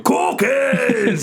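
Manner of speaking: high, shouted cookie-crazed monster voice. Retold or imitated. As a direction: belt it out like a hungry puppet monster. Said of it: "he's got the Cookie Monster voice".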